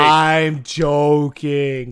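milk the joke slowly; drawn-out tease. Real, not acted. Teasing David in front of everyone, slow and drawn out.

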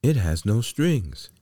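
high, loud cry of wonder; amazed exclamation. A narrator's reading. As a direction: marvel aloud at the sight. Crowd exclaims over stringless puppet.

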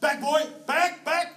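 calling the dog. Retold or imitated. Farmer calls dog back behind the mob, slow loud call. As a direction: drag the call out across the hill. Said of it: "And you go".

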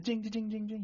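high imitated bell, rapid repeats; door bell jingle. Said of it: "the door just jing".

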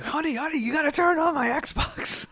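very high-pitched, hammed-up call home; exaggerated high urgent begging; mock urgent plea. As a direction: plead with a partner down the phone, comically high. Imagined frantic call to partner at home.

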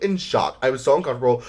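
usual speaking voice

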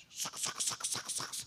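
whispered sac sac clicks; sound effect. Retold or imitated. Preacher mimics the sound of sipping cocktails.

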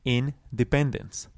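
slow, careful independence; slow model word. Shown as a demonstration. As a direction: say Independence slowly, every syllable clear. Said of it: "So try to say it with me".

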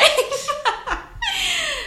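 long high laugh; real drawn-out giggle. Real, not acted. Laughing after finishing story.

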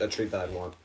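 ordinary talk, nothing marked

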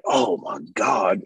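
slow, drawn-out awe; slow stunned realization. Retold or imitated. Realizing he may be a father, slow and stunned. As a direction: let it sink in. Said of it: "I was like".

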